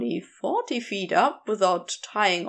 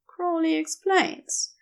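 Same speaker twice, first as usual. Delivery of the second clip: ordinary delivery, nothing marked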